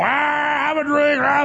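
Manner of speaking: high, sustained boozy holler; drunken revellers. Retold or imitated. Host imitates Secret Service men out drinking, drunken holler. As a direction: imitate a rowdy drunk crowd in one long high cry. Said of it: "they're all drunk and everything".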